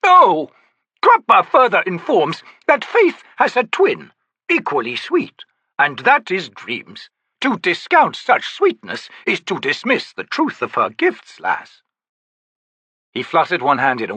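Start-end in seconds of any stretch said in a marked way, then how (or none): 0.0-0.5 high loud "Oh!" as if remembering; sudden exclamation. Acted. Cropper turns back suddenly. 1.0-11.8 lofty, theatrical, higher; grand old Daru. Acted. Cropper continues.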